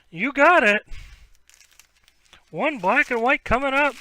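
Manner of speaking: happy to oblige, call it out bright; eager to serve. Real, not acted.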